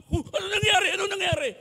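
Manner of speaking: high, loud fretting; fussing alarm. Retold or imitated. Grandfather fussing over his fallen grandchild, high and alarmed. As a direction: fuss anxiously over the little one.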